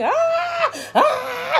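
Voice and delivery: very high, loud "aah" scream; imitated scream. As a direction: re-enact a child's piercing screams, twice. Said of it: "And I'm screaming".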